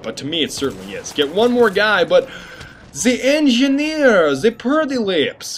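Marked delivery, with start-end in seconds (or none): 3.0-5.6 slow, high mock-accented call; lightly hammed-up, drawn-out character voice; put-on character voice. Mimics a character mid-game.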